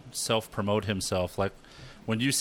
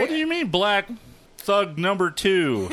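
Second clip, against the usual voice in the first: high, slow incredulous mock question; mock baffled thug casting; 9 semitones higher, 4 dB louder, slower than usual. Friend imagining casting directors, mock disbelief. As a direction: drag out the disbelief, mocking. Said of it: "we'll all be like".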